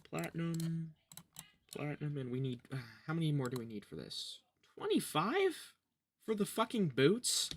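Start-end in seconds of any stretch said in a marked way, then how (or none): 4.7-7.5 can't believe the price; outraged disbelief. Real, not acted. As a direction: pitch jumps in outraged disbelief. Outraged at item cost.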